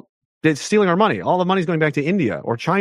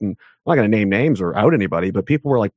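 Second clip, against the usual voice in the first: ordinary talk, nothing marked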